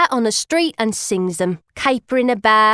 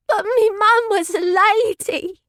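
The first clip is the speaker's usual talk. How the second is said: high, tearful blubber; 11 semitones higher than usual. Acted. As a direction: protest through sobbing tears. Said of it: "I blubbers all snotted up and teary".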